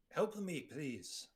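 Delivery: high, loud cry for help; yelled plea. Acted. Weak character yells to Dion for help, yelled plea. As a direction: yell the plea, easing off on please. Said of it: "yells to Dion".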